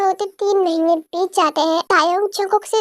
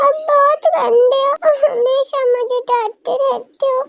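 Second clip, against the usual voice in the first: put-on sulky child; 7 semitones higher than usual. Scolded boy complains to Tae, higher and whiny. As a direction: pout it out like a scolded kid.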